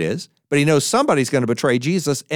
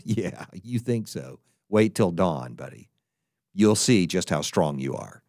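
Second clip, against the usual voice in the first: knowing, quiet Jesus; 9 semitones lower, 6 dB quieter than usual. Retold or imitated. Jesus answers Peter knowingly.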